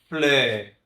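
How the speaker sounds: "Fleur" slow with a rounded eu; French fleur. Shown as a demonstration. Teacher repeating "Fleur" for the class. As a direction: model "Fleur" clearly. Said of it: "perceba esses dois sons".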